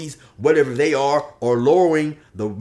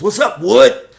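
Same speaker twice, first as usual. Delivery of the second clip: loud, high, hearty greeting; Polar Bear; 9 semitones higher, 12 dB louder than usual. Acted. Prison buddy greeting crying Josh, loud and hearty. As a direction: holler a hearty hello to a buddy. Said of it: "Polar Bear says".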